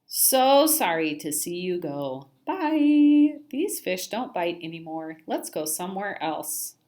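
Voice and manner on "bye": loud high bye. Acted. Fish waving fishermen off.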